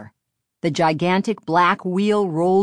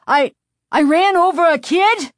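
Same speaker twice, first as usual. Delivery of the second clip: high, loud, stammered shock; stammer, then cry out aghast; horrified cry; 12 semitones higher, 6 dB louder than usual. A narrator's reading. Driver thinking he has run over a child, horrified cry. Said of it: "his eyes wide with horror".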